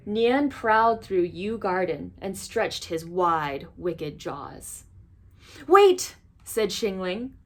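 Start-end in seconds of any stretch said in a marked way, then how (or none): 5.7-6.2 high, loud call of wait; girl shouting to halt the monster; urgent call. Acted. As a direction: stop him once more. Girl stops the monster again.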